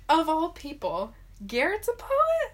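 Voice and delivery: high, rising disbelief; incredulous. Retold or imitated. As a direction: sound totally baffled. Incredulous retold reaction.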